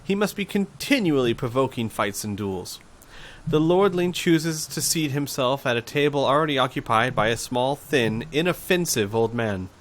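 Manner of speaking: ordinary talk, nothing marked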